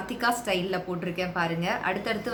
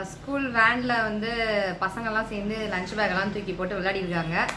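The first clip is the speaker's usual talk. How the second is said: usual speaking voice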